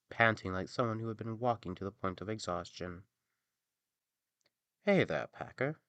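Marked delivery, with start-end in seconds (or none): 4.8-5.8 lightly raised donkey voice; a friendly call from the donkey. Acted. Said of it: "said the donkey".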